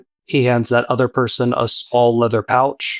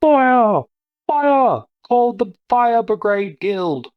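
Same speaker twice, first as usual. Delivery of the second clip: very high alarmed shout, slowing on the last line; panicked yelling; 11 semitones higher, slower than usual. Acted. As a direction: yell fire like a frightened passerby. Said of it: "You hear someone go".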